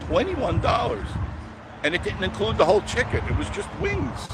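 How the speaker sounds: high, loud disbelief at the price; can't believe what wings cost; price outrage. Real, not acted. Complaining to viewers about the chicken wing price, incredulous.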